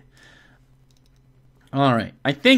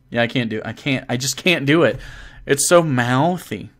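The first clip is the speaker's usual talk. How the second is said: ordinary talk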